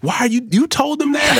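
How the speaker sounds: very high scolding mock voice; high, falsetto mock scold; imitating Ruthie. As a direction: squeak out her accusing question. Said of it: "She called me".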